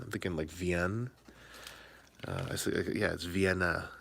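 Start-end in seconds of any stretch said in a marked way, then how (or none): 0.5-1.1 "Vienne" with a silent final E; European pronunciation. Shown as a demonstration. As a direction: say "Vienne" as vee-EN, European style. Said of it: "I'm thinking very European".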